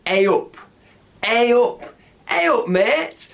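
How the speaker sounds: drawn-out northern greeting; northern English accent. Shown as a demonstration. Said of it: "in the north of England, they might say".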